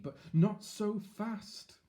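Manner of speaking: slow, high call. Acted. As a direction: call him back, drawn out. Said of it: "called the wise man".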